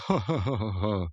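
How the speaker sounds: put-on chuckling "oh-ho-ho"; mock group laugh. Blonde guys laughing together, mock laugh. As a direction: imitate a bunch laughing along. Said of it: "they all kinda laugh".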